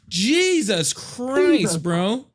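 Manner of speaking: high, slow drawn-out exclamation; stunned. Real, not acted. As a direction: stretch it out in disbelief. Disbelief after the call.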